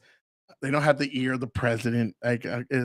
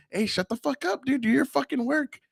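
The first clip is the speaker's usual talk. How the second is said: joking scolding; 9 semitones higher than usual. Retold or imitated. Teacher pretending to scold one of the guys, joking manner. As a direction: rib him as if giving orders. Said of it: "in a joking manner".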